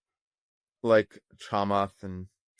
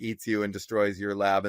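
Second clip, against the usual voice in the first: usual speaking voice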